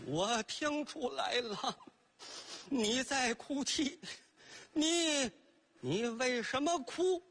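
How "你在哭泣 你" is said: ordinary delivery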